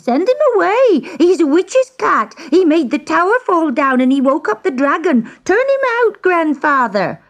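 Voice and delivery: put-on very high, frantic girl; scream. A narrator's reading. Girl warns grandfather about the witch's cat, high screaming. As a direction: plead in high-pitched panic. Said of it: "she screamed".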